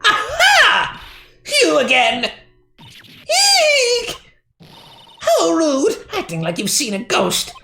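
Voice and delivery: put-on shrill old lady voice. Security lady indignant at the attorney, high fussy voice. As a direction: scold like an indignant old guard. Voicing old guard character.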